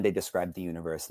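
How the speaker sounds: ordinary talk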